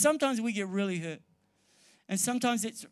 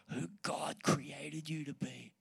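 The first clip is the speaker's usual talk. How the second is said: whisper; 3 semitones lower, 6 dB quieter than usual. Real, not acted. Pastor affirming listeners.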